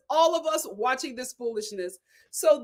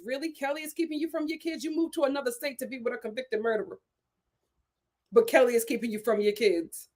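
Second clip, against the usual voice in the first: ordinary delivery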